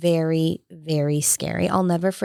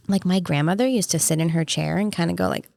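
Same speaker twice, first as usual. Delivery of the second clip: ordinary delivery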